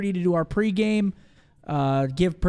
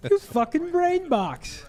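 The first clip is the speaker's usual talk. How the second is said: high, drawn-out mock praise; mock amazement; 11 semitones higher, much slower than usual. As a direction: squeal it in sarcastic awe at their genius. Mock praise for co-host's obvious guess.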